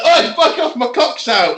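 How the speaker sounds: high, loud cry of protest; mock indignant yell. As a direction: yell as if caught naked. Imagined protest at mum walking in.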